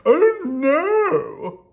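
high, loud wail of a storybook king; distressed king's exclamation; crying out. A narrator's reading. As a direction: cry out in hammed-up dismay. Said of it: "cried the king".

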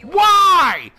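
frustrated gamer yelling; exasperated outcry. Real, not acted. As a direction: wail at the unfairness of the game. Killed again, protests.

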